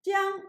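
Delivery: Jiang on a high level tone; first tone. Shown as a demonstration. Teacher showing students Jiang in the first tone. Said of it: "pronounced in the first".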